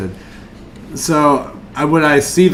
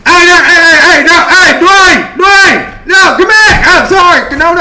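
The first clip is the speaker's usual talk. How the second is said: high, loud desperate calling; pleading shout; 7 dB louder than usual. Acted. Fan calls Dwayne to come back, desperate shout. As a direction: yell after someone walking away, frantic and pleading. Pleads after departing celebrity.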